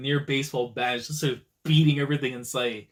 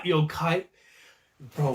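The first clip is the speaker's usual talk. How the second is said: ordinary talk, nothing marked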